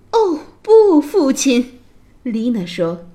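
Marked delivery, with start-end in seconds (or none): 0.1-1.7 high, loud cry of refusal; protesting daughter. A narrator's reading. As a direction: object sharply to your father. Princess protests to her father.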